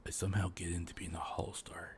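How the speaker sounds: low conspiratorial whisper; scheming under his breath; hushed whisper. Pretend. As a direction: whisper it like a secret plan. Pretending to sneak into Hololive.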